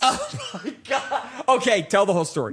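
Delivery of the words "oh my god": real laugh through drawn-out words; laughing disbelief. Real, not acted. Host hearing the lie lasted nine months, laughing disbelief. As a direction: crack up, stretching the words in disbelief.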